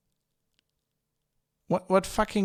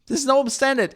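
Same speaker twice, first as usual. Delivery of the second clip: usual speaking voice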